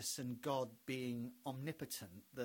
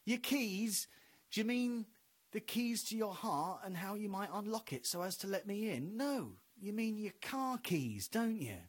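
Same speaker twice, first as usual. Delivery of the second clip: sarcastic God; 5 semitones higher than usual. Retold or imitated. God mocking someone looking for their keys. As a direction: tease the caller. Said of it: "God's like".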